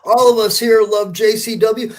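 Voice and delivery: ordinary delivery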